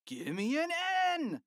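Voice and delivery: high-pitched cheer chant; cheerleaders' shout. A narrator's reading. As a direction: call out the next letter like a cheerleader. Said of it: "shouted the cheerleaders".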